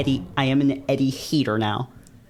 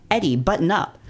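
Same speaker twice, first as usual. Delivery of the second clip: a light hammed-up order to shut up; lightly voiced scold, higher; higher mock scold; 7 semitones higher than usual. Player scolding Eddie with a mock order.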